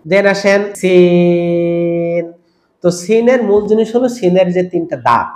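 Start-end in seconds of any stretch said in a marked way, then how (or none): 0.8-2.2 "সিন" with a very long, level ee; long alif stretch. Shown as a demonstration. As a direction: hold the vowel of "সিন" on one steady note. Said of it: "চার আলিফ টেনে বলবো".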